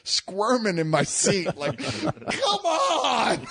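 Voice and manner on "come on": high laughing mock yell of impatience; impatient shout. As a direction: cry out like you can't sit any longer. Squirming impatient in seat.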